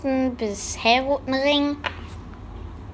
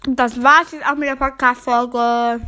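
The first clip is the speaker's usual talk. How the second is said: odd put-on voice, a bit louder; 4 dB louder than usual. Shown as a demonstration. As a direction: say it in a funny altered voice. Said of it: "Nochmal normal".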